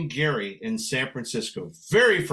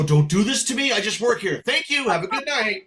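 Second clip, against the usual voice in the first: higher, louder comic protest; mock pleading; 6 semitones higher, 3 dB louder than usual. Singer begging off from grateful husband, mock pleading. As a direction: bail out with a high-pitched sign-off.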